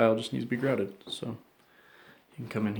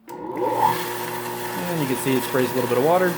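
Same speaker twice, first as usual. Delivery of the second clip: raised voice over the running saw; louder, slower, pushing over machine noise; shouting over table saw; 6 dB louder than usual. Real, not acted. Saw running while he talks.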